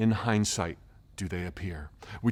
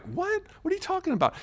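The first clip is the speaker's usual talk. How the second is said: much higher, softer bewildered "What?"; confused surprise; 4 dB quieter, faster than usual. Retold or imitated. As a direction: sound genuinely baffled. Confused reply to brother's teasing.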